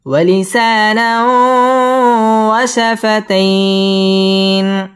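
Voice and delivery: very slow drawn-out chanting; Quranic recitation. Acted. Reciter modeling the verse for students, slow chant. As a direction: stretch each vowel in melodic recitation.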